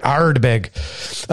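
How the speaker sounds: "Ardbeg" in an Irish pirate brogue; Irish pirate accent. Pretend. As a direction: roll out "Ardbeg" like an Irish buccaneer. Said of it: "like, a, a Irish pirate".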